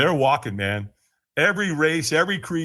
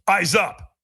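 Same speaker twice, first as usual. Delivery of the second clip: short retold reprimand, a bit higher; scolding trainer; 4 semitones higher, 3 dB louder than usual. Trainer corrects the man at the fire lane, mild scolding. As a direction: give a mildly scolding command. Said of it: "Kinda scolds me".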